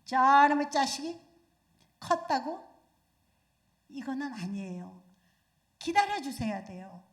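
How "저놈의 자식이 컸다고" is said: loud exasperated outburst; mother yelling after the slammed door; angry mother. Retold or imitated. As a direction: snap at the sulking child. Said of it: "어머니들이 되게 하시는 말씀이".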